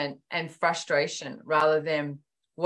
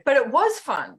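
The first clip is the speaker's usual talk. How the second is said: higher and louder, insisting it was fun; indignant protest; 8 semitones higher, 5 dB louder than usual. Retold or imitated. Telling Gary off about Pilates, a loud protest. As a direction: protest like a wounded kid. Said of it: "I went".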